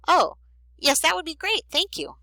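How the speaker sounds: high-pitched, thrilled thanks; delighted acceptance. Acted. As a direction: accept a friend's invitation with eager delight. Lonely girl accepts lunch invitation.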